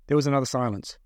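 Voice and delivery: plain reading voice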